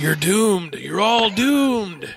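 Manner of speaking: slow, drawn-out doom prophecy; slow ominous raspy drawl. Retold or imitated. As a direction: croak a grim warning like a crazy old man. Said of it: "our buddy Ralph".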